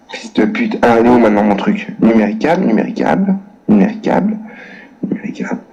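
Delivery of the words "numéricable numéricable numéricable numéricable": fading to whisper. Real, not acted. Repeating the name as he types.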